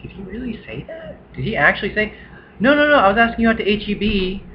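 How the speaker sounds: high, incredulous disbelief; mock incredulity. Friends retelling roommate's lie, high incredulous. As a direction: sound stunned he'd say that.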